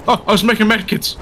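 loud, high burst of surprise; sudden realization. Real, not acted. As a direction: exclaim it as it dawns on you. Sudden realization while playing.

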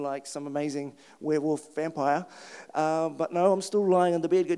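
plain reading voice

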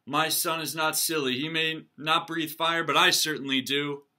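loud, indignant defense; angry father. Acted. Dragon father defending his son, loudly angry. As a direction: stand up for your son, fuming. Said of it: "stormed out of the house".

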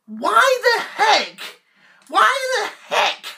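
high, slow, drawn-out whine of disbelief; exasperated outburst. Acted. Doctor Who fan wailing at the camera, high and exasperated. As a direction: wail it to the sky like it's a disaster.

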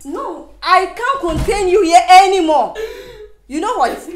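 high, very loud outburst; furious refusal. Acted. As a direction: shout down the pleading in fury. Throwing Cindy out.

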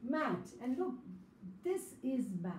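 ordinary delivery

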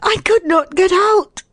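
very high, plaintive woman's cry; piteous. A narrator's reading. Trapped woman explains herself to the man, piteous. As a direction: plead it pitifully in a high voice. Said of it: "I cried piteously".

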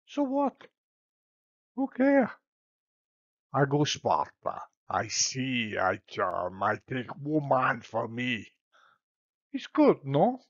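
cocky Spanish suitor; Spanish accent. Acted. Paris voiced in stage Spanish.

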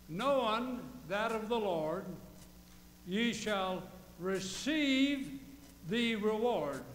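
slow, drawn-out scripture read; preacher reading the verse slowly. Acted. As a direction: lay each word out for the flock. Reads scripture aloud.

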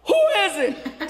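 high-pitched acted yell. Man in hotel room answers the knock, yelling. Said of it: "He yells".

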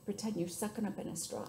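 ordinary delivery

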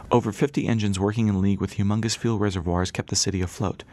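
plain reading voice

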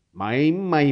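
put-on fed-up old man grumbling. A narrator's reading. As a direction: scold it like a stubborn mule. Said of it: "bombăni Zeb".

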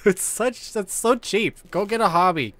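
loud, high-pitched scolding; indignant. Real, not acted. Scolds demon after cheap scare.

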